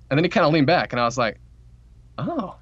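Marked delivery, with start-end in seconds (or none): 2.2-2.5 high, bright little aside of triumph; pleased inner thought. Retold or imitated. Relieved manager thinking to himself after the talk turns, pleased and high.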